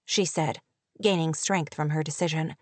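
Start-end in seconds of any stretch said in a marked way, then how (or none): none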